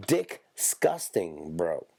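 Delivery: Disgusting drawn out very slowly; stretched-out disgusting. Shown as a demonstration. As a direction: stretch Disgusting long and slow. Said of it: "more disgusting than just regular disgusting".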